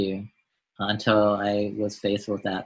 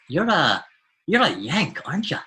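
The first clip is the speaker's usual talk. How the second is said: imitated stranger's swooping, higher-pitched question; door-to-door collector; 7 semitones higher than usual. Collector quizzing the student who is signing his form, imitated with a high lilt. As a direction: put on the collector's voice, lilting higher. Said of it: "he said to me, he goes".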